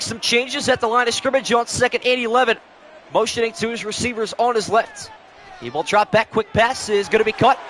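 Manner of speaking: lively sportscaster calling the play. Acted. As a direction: call it like a TV broadcaster. Madden play-by-play call.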